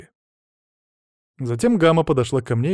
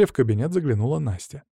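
ordinary delivery

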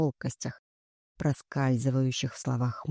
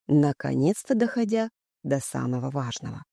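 ordinary delivery

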